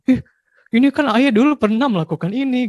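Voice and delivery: put-on high, loud teasing kid voice; teasing child. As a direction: tease dad like a cheeky child. Child teasing father about past. (suara anak yang meledek, tinggi dan keras)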